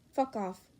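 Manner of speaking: plain reading voice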